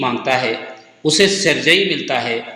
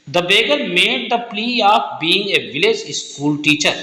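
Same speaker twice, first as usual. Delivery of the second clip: slow dictation; 3 semitones higher, much slower than usual. Shown as a demonstration. Teacher dictates answer slowly.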